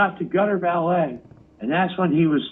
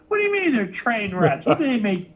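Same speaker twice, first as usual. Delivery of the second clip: sarcastic disbelief; 10 semitones higher, faster than usual. Retold or imitated. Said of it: "I'm like".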